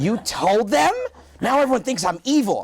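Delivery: high, loud indignant imitation; hammed-up accusation from an offended wife; mock outraged wife. Wife after watching the show, mock outraged.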